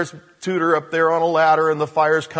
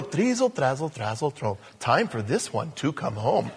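lightly voiced cartoon wizard incantation; a hint of a cartoon magician; Mister Wizard; 4 semitones lower, 5 dB quieter than usual. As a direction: chant the spell softly, dropping lower. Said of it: "Mister Wizard would go".

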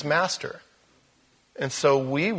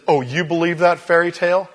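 louder scoffing sneer at the believer; mocking scoffer; 4 semitones higher, 7 dB louder than usual. A sceptic sneers at a believer, loud and mocking. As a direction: scoff as if the faith is childish.

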